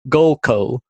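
goal call in a flat northern accent. Shown as a demonstration. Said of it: "that sounds so wrong in my northern accent".

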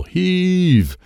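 high, drawn-out heave call; yelled. A narrator's reading. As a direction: yell it to a pulling team. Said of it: "Sensei Dennis yelled".

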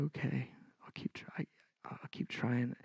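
breathless whisper, giving in; whispered surrender. Retold or imitated. Retelling his tearful surrender to God.

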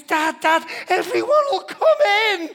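high, loud, urgent calling; panicked daughter. Retold or imitated. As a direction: call out from the shower in a flustered rush. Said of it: "And she says".

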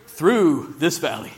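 "through" drawn out slowly; slow right down and lean on "through"; stress on THROUGH. Shown as a demonstration. Preacher urging self-reminder, slow stress on "through". Repeats the stressed key word.

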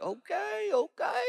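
high, hushed uneasy okay okay; awkward okay. Retold or imitated. Disciples watching Jesus reprimand Peter, high and hushed uneasy okays. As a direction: squeak it out like an awkward bystander.

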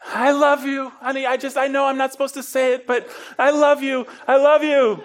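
high, bursting declaration of love; gushing confession. Retold or imitated. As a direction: blurt it out high and overflowing. Blurting out love in the car.

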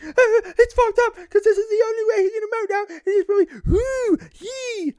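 very high, squealing cartoon clown voice; clownish Ron. Mocking Ron's reaction to the horror, in a clownish falsetto. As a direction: squeak it out in a silly falsetto with whoops. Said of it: "and like a clown".

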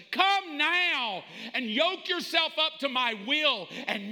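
high, forceful preaching; Jesus commanding. Acted. Jesus telling Mark to yoke up, forceful insistence. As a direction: press each demand home with urgency. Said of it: "Jesus is telling us".

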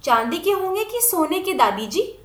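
a hint of a curious child; lightly voiced little girl. A narrator's reading. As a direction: ask grandma as a small child would. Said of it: "Jalpa पूछती".

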